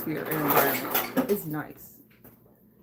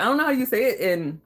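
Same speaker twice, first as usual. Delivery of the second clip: normal talking voice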